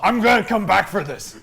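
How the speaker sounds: loud, high, emphatic repeat. Acted. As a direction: belt the line out. Player repeats the line, much louder.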